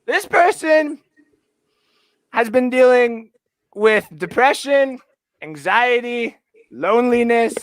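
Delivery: high, dramatic introduction with long pauses; hammed-up showman's reveal, slowing down; mock announcer. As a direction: build up a surprise guest like an emcee. Announces a new fan guest.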